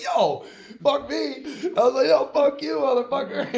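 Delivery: laughing cursing. Retold or imitated. Driver curses out the Wendy's staff, laughing loudly. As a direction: curse them out while cracking up. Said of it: "And I was like".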